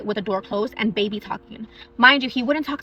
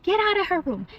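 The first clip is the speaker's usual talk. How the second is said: high stern mock command to the ghost; mom scolding a ghost; 9 semitones higher than usual. Mom pretending to scold the blue lady, high and stern. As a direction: order the ghost out like a strict grandma. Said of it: "pretends like she's telling the lady".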